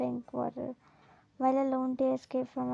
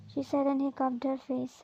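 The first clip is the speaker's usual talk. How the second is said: plain reading voice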